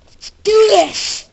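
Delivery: high, drawn-out battle yell; psyched-up yell. Real, not acted. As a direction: yell it to fire yourself up. Psyching up to play.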